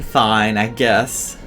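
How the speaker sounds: ordinary talk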